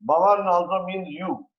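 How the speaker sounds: ordinary delivery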